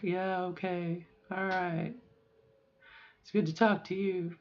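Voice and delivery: phone zombie. Retold or imitated. On the phone to mom, subdued like a zombie. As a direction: drag it out softly, lifeless. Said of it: "talking on the phone like a zombie, you know, in my lower register".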